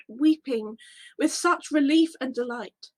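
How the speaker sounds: usual speaking voice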